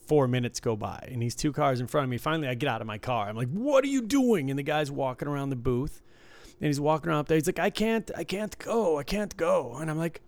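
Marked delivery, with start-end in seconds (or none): 3.5-4.5 high, loud angry yell; retold scream. Furious guy yells at stalled driver in garage. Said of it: "I'm screaming at him". 7.6-9.7 whiny stuck driver. Retold or imitated. Stuck driver voiced in story.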